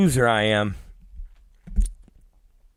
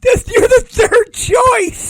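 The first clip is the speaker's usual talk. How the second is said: squealing with laughter, much higher; laughing hard; 4 dB louder, slower than usual. Real, not acted. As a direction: laughs through the teasing words. Repeats tease, still laughing.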